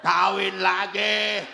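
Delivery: slow, loud drawn-out shout. Acted. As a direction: shout both words slowly. Seruan tegas soal kawin lagi. (teriakan keras dan lambat)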